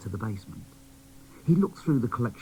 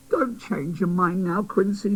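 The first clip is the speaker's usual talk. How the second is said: high, pleading fairy; fairy begging the boy doll to go, high; 9 semitones higher, 4 dB louder than usual. Acted. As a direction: beg him not to back out. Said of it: "begged Griselda".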